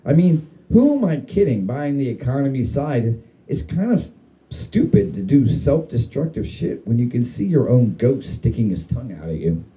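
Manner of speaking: ordinary delivery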